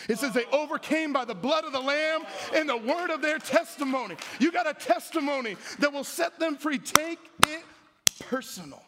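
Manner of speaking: high, driving preacher's delivery; fervent preaching. Real, not acted. Preacher urging the church with their testimony, fervent and high. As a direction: preach it out, pitched well up.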